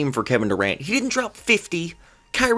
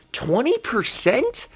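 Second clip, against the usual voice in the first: higher, slower incredulous repeat; louder disbelief; 7 semitones higher, slower than usual. Real, not acted. Fan repeating the stat in stunned disbelief. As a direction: stretch it out, stunned.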